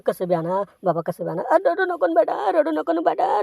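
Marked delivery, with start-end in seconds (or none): 1.5-3.4 higher, cooing comfort; a father cooing to his newborn; soothing a baby. Acted. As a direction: hush the crying infant gently.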